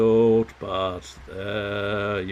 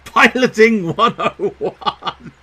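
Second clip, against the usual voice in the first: loud, high cheer with laughter; triumphant laughing; 10 semitones higher, 10 dB louder, much slower than usual. Real, not acted. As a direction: celebrate the win out loud. Wins achievement.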